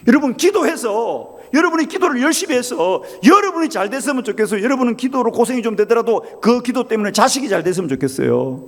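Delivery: impassioned preacher's question; urgent preaching appeal. Acted. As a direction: press the congregation for an answer.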